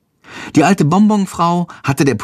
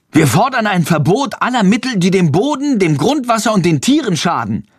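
loud interjection; 8 semitones higher than usual. A narrator's reading. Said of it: "mischt Friedrich sich laut ein".

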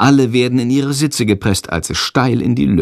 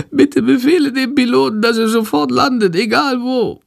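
very high, pleading sick bat; weak; 14 semitones higher than usual. A narrator's reading. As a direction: beg in a tiny, ailing voice. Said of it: "Shandors Stimme ist ganz schwach". (schwach flehend, sehr hoch)

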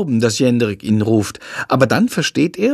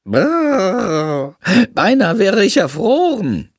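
high bat voice hamming up a shiver; exaggerated shivering; 7 semitones higher, slower than usual. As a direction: overplay the cold, teeth chattering. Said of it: "übertreibt Shandor".

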